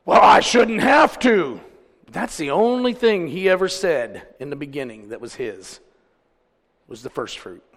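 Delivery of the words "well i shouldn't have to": loud, high, slow objection; hammed-up indignant refusal; mock protest. Preacher mimicking member refusing to tithe, mock indignation.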